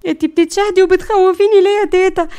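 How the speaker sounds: high, loud frightened protest; scared. Acted. Layla is scared by grandmother's last prayer, alarmed. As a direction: protest in a panic. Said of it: "انا كده خفت".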